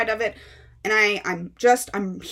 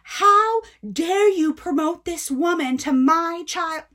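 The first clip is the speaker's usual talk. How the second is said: mocking irate mom; 7 semitones higher than usual. Mother scolding bookseller for selling her daughter the book, high and mocking. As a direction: ham up the furious mom, slowing at the end. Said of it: "And she's like".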